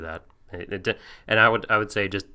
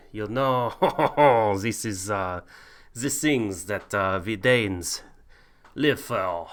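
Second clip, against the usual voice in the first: put-on loud, hammy Viking accent; 4 dB louder than usual. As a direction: boom it out as a bad Viking. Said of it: "here we go for the Vikings".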